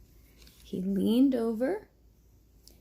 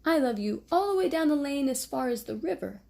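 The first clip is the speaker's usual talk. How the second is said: excited cry; 6 semitones higher, 4 dB louder than usual. Acted. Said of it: "cried Little Nut Brown Hair".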